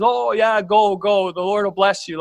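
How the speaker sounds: a touch of sarcastic go-ahead; lightly mock the flattering prophets; mild mock blessing. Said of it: "first he sort of mocks".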